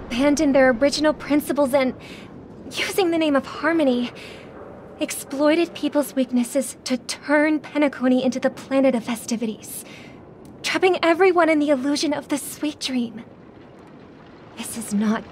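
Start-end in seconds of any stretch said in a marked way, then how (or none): none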